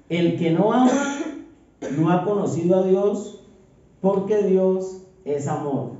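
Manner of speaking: slow, solemn reading of the verse; measured pulpit reading voice; scripture reading. Acted. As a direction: read it like holy words, drawn out. Reads a Bible verse aloud.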